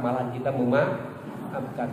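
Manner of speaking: ordinary talk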